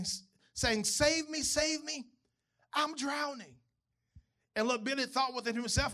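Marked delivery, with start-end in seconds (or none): 0.8-3.6 mock screaming woman. A narrator's reading. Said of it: "a woman screaming".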